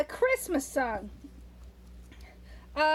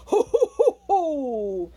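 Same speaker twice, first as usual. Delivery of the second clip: loud, jolly ho ho; hearty Santa Claus laughter; 8 dB louder, slower than usual. Acted.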